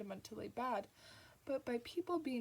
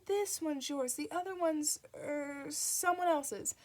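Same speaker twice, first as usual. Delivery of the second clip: high, louder flustered nun, slowing on the end; 6 semitones higher, 6 dB louder, slower than usual. A narrator's reading. Nun hands over the wrong baby, flustered and high. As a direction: cover a mistake in a hurry. Sister Mary voiced.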